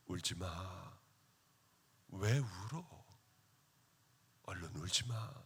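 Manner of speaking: low, soft voice hushing someone crying; soft comforting. Retold or imitated. Homeless man hugs crying pastor, soft low comforting hush.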